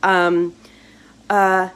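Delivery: ordinary delivery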